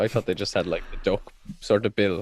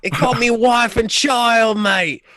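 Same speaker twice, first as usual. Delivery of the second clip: slow, much higher and louder mock Aussie wail; Australian accent; 13 semitones higher, 8 dB louder, slower than usual. Friend playing a Bunyip victim in a loud mock Australian accent. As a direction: ham up a broad Australian accent, crying out. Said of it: "the Australian accent is quite hard to do".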